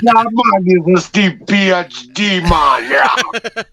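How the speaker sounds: slow, drawn-out mock Jamaican accent. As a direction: put on a hammed-up Jamaican accent. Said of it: "he'd sound Jamaican".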